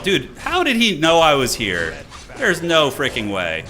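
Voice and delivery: loud, high, slow disbelief; can't believe he found you; surprised. Real, not acted. Caught off guard by enemy.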